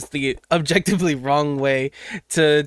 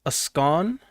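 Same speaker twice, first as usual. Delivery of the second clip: British accent; 2 semitones higher than usual. Shown as a demonstration. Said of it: "not doing it with a British enough accent".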